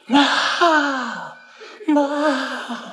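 high calling out for mother; hollered loud. Retold or imitated. Stuck in the disc, calling mother across the branch, high holler. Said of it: "I hollered loud".

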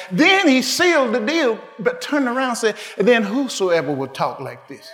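climbing loud and high into a shout; preacher building to a shouted climax; rising preaching. Real, not acted. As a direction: build it to a roar.

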